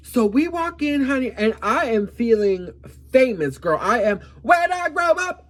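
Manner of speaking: high, loud, giddy star fantasy; giddy excitement. Real, not acted. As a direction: gush like you feel famous. Drunk and feeling famous.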